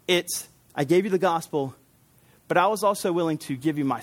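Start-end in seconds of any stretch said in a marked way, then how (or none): none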